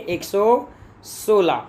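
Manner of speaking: एक सौ सोलह stretched out slowly, each number laid down; teacher dictating एक सौ सोलह slowly; slow emphatic answer. Shown as a demonstration. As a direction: drag out एक सौ सोलह so students can write it.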